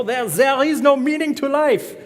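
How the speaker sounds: high, loud mock-French philosopher; bad French accent. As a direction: declaim it like a cartoon French philosopher. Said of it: "that's my best French accent".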